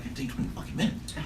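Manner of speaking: breathy whispered quote of a friend; whispered aside. Retold or imitated. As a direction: hush it out like a secret. Friend voiced in retold story.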